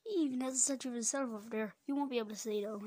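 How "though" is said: normal talking voice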